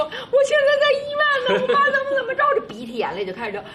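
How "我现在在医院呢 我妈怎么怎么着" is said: high, tearful wail, half laughing; crying on the phone. Retold or imitated. Audition improv, calling dad about mom, crying high. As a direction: play a daughter sobbing out bad news. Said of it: "一边哭一边说".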